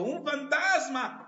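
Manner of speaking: loud, high, drawn-out cry of fright; disciples' alarmed cry. Acted. Disciples spot Jesus walking on the sea, alarmed cry. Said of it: "se turbaron diciendo".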